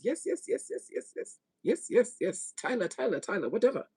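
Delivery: put-on Indian accent. Reader voicing [name]'s reaction to young girls, mock Indian accent. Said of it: "That's my Indian voice".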